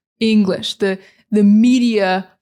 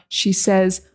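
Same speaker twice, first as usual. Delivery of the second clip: usual speaking voice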